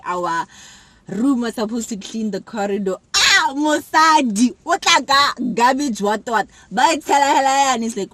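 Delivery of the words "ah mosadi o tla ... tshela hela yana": excited rant. Retold or imitated. Retelling chaotic cleaning day.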